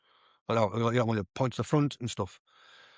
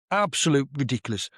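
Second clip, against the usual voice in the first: ordinary delivery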